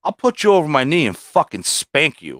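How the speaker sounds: high, loud retold threat; mock-angry threat to the kid; snapping back. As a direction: fire it back like you've lost it. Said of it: "Like, I just snapped".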